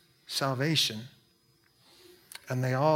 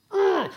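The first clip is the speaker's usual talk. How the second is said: frustrated groan. Retold or imitated. Acting out his own frustration.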